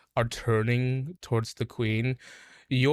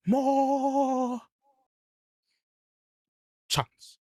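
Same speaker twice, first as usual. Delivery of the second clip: very high, slow threatening queen's croak; menacing bullywug queen; 11 semitones higher, slower than usual. Acted. Bullywug queen threatening her own soldiers, very high and menacing. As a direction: threaten them from on high, drawing it out.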